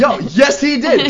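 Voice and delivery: thrilled reveal that he fell in love; excited yes. Real, not acted. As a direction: blurt the good news with delight. Excited answer to question.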